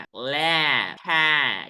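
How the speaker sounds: ordinary delivery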